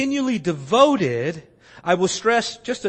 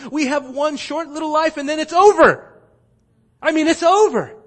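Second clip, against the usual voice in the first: urgent preaching; 8 semitones higher, 7 dB louder than usual. Real, not acted. Preacher's urgent peak on life.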